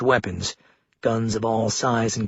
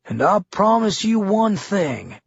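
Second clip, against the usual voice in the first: slow, higher, ominous; menacing governor; 7 semitones higher, slower than usual. A narrator's reading. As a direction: promise it slowly with menace. Governor voiced in story.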